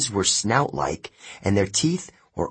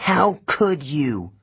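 slow, hurt fury; angry; 6 semitones higher, slower than usual. A narrator's reading. Annabeth confronting Luke about the tree, furious. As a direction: demand it through rising anger. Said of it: "Annabeth sounded so angry".

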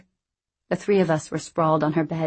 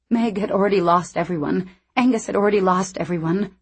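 plain reading voice

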